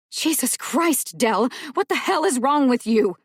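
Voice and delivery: loud, slowed, outraged shout at Dell; furious yell. Acted. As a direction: blast him for overstepping.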